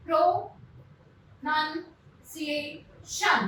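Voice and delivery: pronunciation very slowly, a pause after each syllable; broken into syllables. Shown as a demonstration. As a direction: split pronunciation as pro, nun, ci, ation. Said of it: "sound से हम उन्हें break करेंगे".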